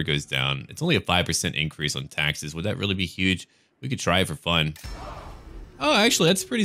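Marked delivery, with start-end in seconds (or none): 5.8-6.6 much higher surprised oh; startled, pitched-up oh actually; sudden realization. Real, not acted. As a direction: catch yourself mid-thought. Noticing a big tax effect.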